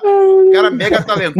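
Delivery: loud, high hype for the guest; hyped announcement. Real, not acted. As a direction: sell the guest big and loud. Hyping the guest.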